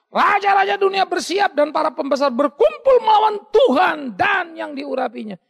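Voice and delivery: loud, high, shouted sermon; fiery preacher crying out the psalm; full-force preaching. Acted. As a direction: thunder the scripture at the crowd.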